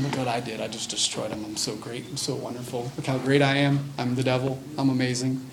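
low, soft self-satisfied boasting; mock bragging. The devil sits back and laughs, quietly bragging. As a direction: gloat quietly to yourself, full of yourself. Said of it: "He's all bragging".

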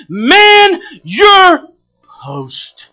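high, loud pulpit cry; each word held and punched; shouted exhortation. Real, not acted. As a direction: bellow it as a rallying command. Preacher's shouted command to congregation.

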